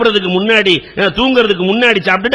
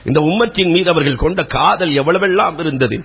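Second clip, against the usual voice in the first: usual speaking voice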